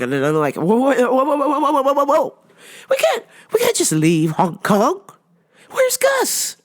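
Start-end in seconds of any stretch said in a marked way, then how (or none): 0.6-2.4 frantic whoa. Retold or imitated. Frantic protest at show ending. 2.9-6.6 mock panic. Mock panic over missing son.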